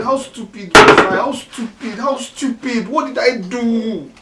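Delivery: loud self-reproaching cry. Acted. Father shouting at himself in regret. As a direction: cry out at his own stupidity.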